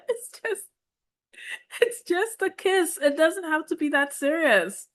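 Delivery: playful high-pitched pleading; mock exasperation. Real, not acted. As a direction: laugh off mom's seriousness. Teasing repeat, higher still.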